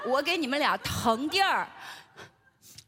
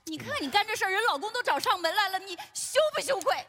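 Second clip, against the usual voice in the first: high-pitched reproach; wife shaming her husband; indignant scolding; 6 semitones higher than usual. Acted. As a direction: scold him with raised pitch.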